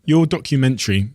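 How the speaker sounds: normal talking voice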